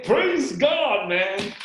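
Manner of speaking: high, loud shout of praise; retold jubilant praise; excited. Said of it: "I was so excited".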